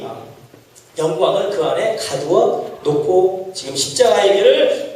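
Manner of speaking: normal talking voice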